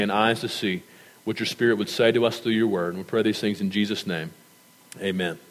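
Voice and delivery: hushed congregational prayer. Acted. Pastor leads prayer.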